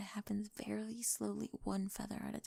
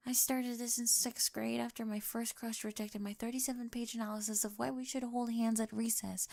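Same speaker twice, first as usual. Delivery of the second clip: normal talking voice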